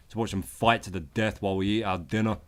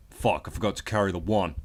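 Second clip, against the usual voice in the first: ordinary talk, nothing marked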